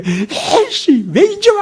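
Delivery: put-on whiny crying child voice, pitched far up; a crying toddler scolding a late mom; sulky child. Child snapping at mother who came late, whining. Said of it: "막 어린애가 막 엄마 아빠한테 달라드는 애".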